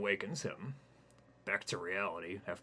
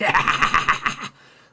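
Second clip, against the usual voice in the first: evil cackle; 9 semitones higher, 19 dB louder than usual. Acted. Spider laughs after threat.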